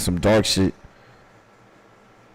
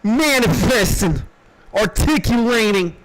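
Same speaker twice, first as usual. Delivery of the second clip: each word climbing higher, ending in a shout; building hype list; 9 semitones higher than usual. Pretend. Streamer riffing boasts to chat, rising to a mock shout. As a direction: ramp up the brag word by word.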